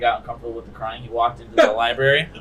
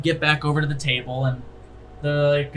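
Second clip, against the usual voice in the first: ordinary talk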